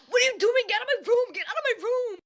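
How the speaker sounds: imitating a frantic cousin, high-pitched; high mock yell; panicked yelling. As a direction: shriek it like someone caught red-handed. Cousin caught, yelling at intruder.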